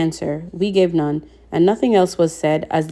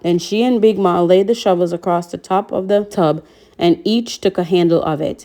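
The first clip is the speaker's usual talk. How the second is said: ordinary delivery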